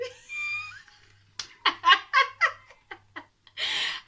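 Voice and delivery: loud, very high cackling laugh; shrieking laugh. Real, not acted. Laughing at her own make-up story, shrieking laugh. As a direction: burst out in long squealing laughter.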